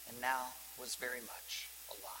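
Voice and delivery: a whispered marvel; preacher whispering about the man alive again. Real, not acted. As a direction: breathe it out in an awed whisper. Sermon point ends in a hush.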